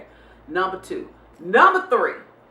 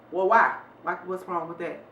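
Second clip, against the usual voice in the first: ordinary delivery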